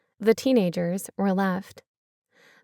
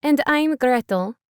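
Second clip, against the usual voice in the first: higher, louder hammed-up German accent; a deliberately bad German accent; 5 semitones higher, 4 dB louder than usual. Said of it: "equally bad German accent".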